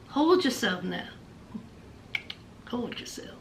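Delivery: very low, hushed caution; Usman. Retold or imitated. Man holding off an eager woman, hushed and low. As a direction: murmur it low, fading almost to a whisper. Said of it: "Usman said".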